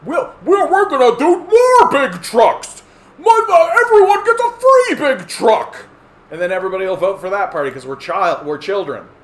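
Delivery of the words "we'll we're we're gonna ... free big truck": shrill, very high and loud pandering shout; mock politician. Mocking a truck-loving party's pitch, very high and loud. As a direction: yell it like a dumb campaign promise. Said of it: "a party that goes".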